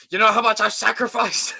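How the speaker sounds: high, loud protest of wounded pride; mock outrage. Streamer protesting about his trend, mock-outraged. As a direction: play up the indignation, pitched high.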